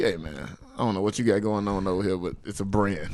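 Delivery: Frank Lucas impression. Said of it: "how Frank Lucas went to go holler".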